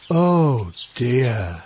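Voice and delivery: low, forlorn little sigh; downhearted and pitched low; sad lament. A narrator's reading. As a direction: let the words sink, dejected. Sad Jacko repeats his lament.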